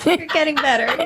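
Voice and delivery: laughing while speaking. Real, not acted. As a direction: say it through laughter. Laughing praise.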